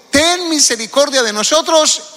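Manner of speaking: a mild cried-out plea; crying out. Retold or imitated. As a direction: call out for mercy. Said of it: "dando voces y diciendo".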